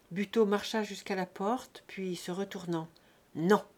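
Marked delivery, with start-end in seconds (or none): 3.3-3.6 flat refusal. Acted. Buteau refuses his share.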